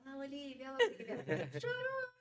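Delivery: caçoada rindo, subindo no fim; zombaria rindo. Retold or imitated. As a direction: imite o marido caçoando, entre risos. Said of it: "ele fala". (marido caçoa da esposa chorona, rindo)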